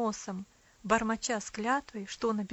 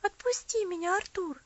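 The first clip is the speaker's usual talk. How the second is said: put-on high, begging girl; pleading; 9 semitones higher, 4 dB louder than usual. A narrator's reading. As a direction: beg to be let go. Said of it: "взмолилась девочка".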